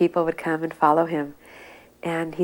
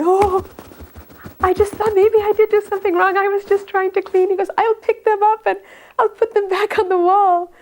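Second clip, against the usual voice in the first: high, louder, anxious pleading; frightened apology; 6 dB louder than usual. Retold or imitated. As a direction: squeak it out like a scared man caught out. Frightened man apologizing to Prabhupada.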